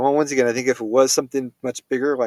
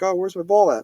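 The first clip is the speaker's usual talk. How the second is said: high eager dog voice; 8 semitones higher, 6 dB louder than usual. Retold or imitated. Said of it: "Reggie's like".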